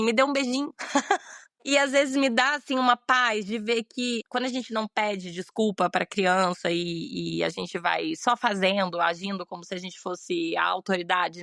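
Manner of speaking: ordinary delivery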